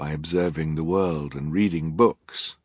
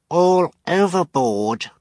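put-on baby voice; 11 semitones higher, 5 dB louder, slower than usual. Narrator voices baby Sunny.

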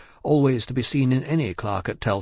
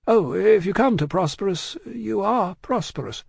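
high, measured agreement, slowing; fussy diplomatic banker; placating concession; 8 semitones higher than usual. A narrator's reading. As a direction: humour him delicately. Lorry concedes to Stryver.

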